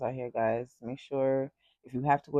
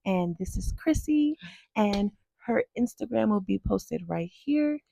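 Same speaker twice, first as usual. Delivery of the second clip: host sign-off; 8 semitones higher, 9 dB louder than usual. Acted. Show sign-off outro.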